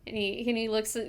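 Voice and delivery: usual speaking voice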